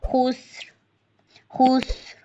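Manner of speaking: खुस्र with a hissing s; whistling seen. Shown as a demonstration. Teacher isolating the seen sound. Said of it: "सीन में सीटी लाजमी सिफ्त है इसकी".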